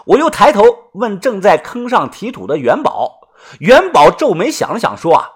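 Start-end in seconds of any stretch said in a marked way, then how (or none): none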